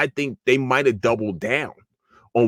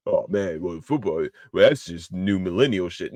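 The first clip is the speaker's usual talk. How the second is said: mock old-school fan; 4 semitones lower than usual. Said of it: "you know who says shit like that".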